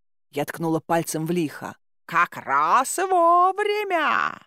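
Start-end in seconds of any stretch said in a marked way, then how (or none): 2.1-4.4 put-on old hag. Hag threatens the guests.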